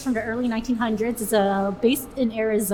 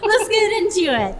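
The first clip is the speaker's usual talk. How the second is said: usual speaking voice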